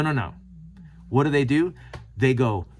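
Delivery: ordinary talk, nothing marked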